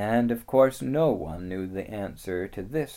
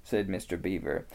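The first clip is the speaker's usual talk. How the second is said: ordinary delivery, nothing marked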